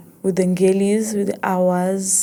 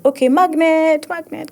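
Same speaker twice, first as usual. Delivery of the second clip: high, playful summons to flying words; calling words in; 10 semitones higher, 3 dB louder than usual. Acted. Poet beckoning stray words to herself, high playful call. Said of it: "And then words just fly around and I'm like".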